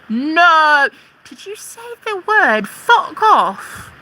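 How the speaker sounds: high, loud whiny mock voice, slowing on stop swearing; mocking prissy troll. Mimicking a troll who scolds her swearing, high and whiny. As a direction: sneer it like a scandalised prude.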